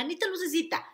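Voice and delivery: lightly voiced mom; mother's pet name. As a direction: call her like mom used to. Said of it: "como me decía mamá".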